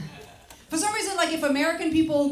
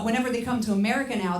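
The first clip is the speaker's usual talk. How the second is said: ordinary delivery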